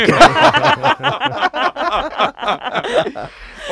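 big high burst of laughter; loud laughter. Real, not acted.

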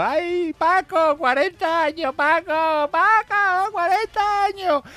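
high, slow, drawn-out wailing wife. Acted. Wife wails to jailed husband Paco, high hammed-up lament. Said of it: "empezó ya a gritar con lágrimas en los ojos".

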